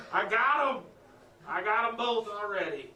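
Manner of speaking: loud, high, thrilled; delighted. Acted. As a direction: crow it out, gleeful. Petting both cats, delighted.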